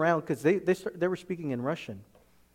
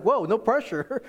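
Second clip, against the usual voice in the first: high, loud, drawn-out reaction breaking into laughter; slow, raised, laughing disbelief; amused mock alarm; 8 semitones higher, 5 dB louder than usual. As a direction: laugh off the pressure. Amused retold reaction to pressure.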